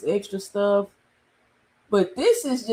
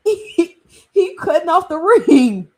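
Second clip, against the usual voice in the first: laughing through the words, louder; half-laughing in disbelief; 6 semitones higher, 7 dB louder than usual. Real, not acted. Streamer laughing at the ref in the clip, laughing.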